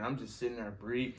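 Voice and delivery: normal talking voice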